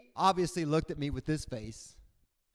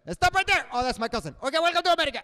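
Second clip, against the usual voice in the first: much higher and louder, a mock guard yelling halt; barking border agent; 10 semitones higher, 8 dB louder, much faster than usual. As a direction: bark an order then switch to friendly. Cuban border agent bit.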